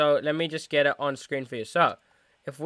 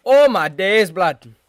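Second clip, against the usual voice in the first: put-on louder, higher exclamation in a London street-slang accent; hammed-up London slang accent, loud and animated; 3 semitones higher, 8 dB louder, slower than usual. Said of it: "Why did I do that ac-, accent".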